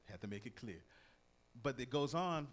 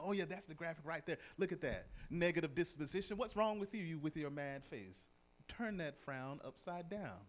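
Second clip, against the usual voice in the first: higher, louder playful mock; teasing cartoon voice; 6 semitones higher, 4 dB louder than usual. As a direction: poke fun at the frowning one. Voicing the comic character.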